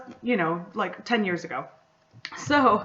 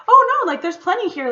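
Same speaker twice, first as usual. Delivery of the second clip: much higher and louder, rushing to correct her; emphatic protest; 11 semitones higher, 9 dB louder than usual. Retold or imitated. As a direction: squeal out an eager no, it's fine. Speaker protests to shop owner.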